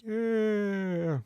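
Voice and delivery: high, drawn-out half-hearted yeah; moderate cheer. Acted. Everyone answering the excited trainer, half-hearted. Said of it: "sort of moderate".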